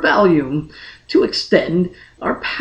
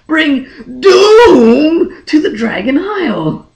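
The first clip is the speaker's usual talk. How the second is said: anguished cry; 7 semitones higher, 5 dB louder, slower than usual. Acted. Elric foresees betraying his people.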